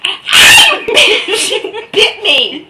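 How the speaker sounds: loud, high laughing yelp; real laughing outcry at the co-host's bite; laughing shout. Real, not acted. Co-host bites him.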